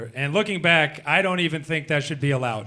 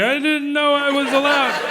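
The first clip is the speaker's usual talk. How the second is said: high, slow, choked-up wonder; tearful straight guy; 10 semitones higher, slower than usual. Retold or imitated. Straight guy on Queer Eye, high and slow with wonder. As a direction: squeak it out like a man moved to tears. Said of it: "He's like".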